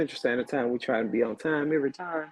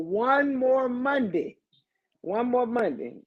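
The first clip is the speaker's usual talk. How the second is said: One more Monday lifted high and stretched slow, each word held; sing-song encouragement; 8 semitones higher, slower than usual. Acted. Host rallying weary listeners toward Friday, sing-song and slow. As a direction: sing it out like a cheer to tired workers.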